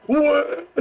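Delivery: high startled gasp. Real, not acted. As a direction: jump at the noise. Startled by a roar.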